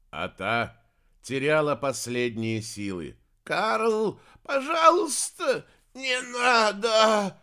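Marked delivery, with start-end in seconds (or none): 3.4-7.4 high, loud, drawn-out begging; desperate pleading. A narrator's reading. Dying sorceress begging her son, desperate. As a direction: beg for life, stretching the words.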